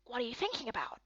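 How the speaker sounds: soft breathy whisper. Acted. Companion whispering a quiet question while admiring statues.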